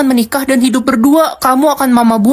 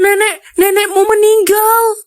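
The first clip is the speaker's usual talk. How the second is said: high, slow panicked announcement; mother cries out to her son that grandma has died; distressed cry; 8 semitones higher, slower than usual. Acted. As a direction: blurt out the death in shock.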